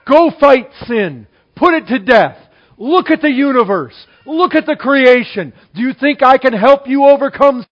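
loud, commanding sermon voice; rally the congregation like a charge; preacher thundering God's command to go; preaching at full force. Acted.

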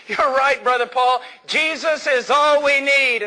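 high, enthusiastic acted outburst; excited young man. Young man to the preacher after a sermon, all excited. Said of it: "he was all excited".

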